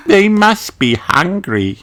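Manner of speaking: put-on a child worrying about the birds; Peppa voice. A narrator's reading. Peppa to Granny about the birds, high child voice. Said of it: "says Peppa".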